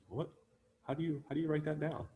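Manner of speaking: imitating puzzled doctors, high and quick; baffled doctors. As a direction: play stumped doctors in a high, hurried voice. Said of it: "were scratching their heads wondering".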